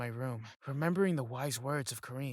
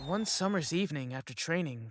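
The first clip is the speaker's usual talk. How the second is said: ordinary delivery, nothing marked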